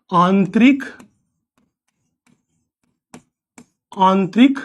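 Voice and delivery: word by word, long pauses between; slow dictation. Acted. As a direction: dictate it so students can write it down.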